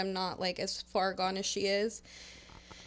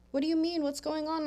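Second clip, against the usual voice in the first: put-on higher, bewildered drunk friend; confused coworker; 8 semitones higher, faster than usual. Drunk coworker asks what is happening, high and confused. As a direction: play the lost, befuddled coworker.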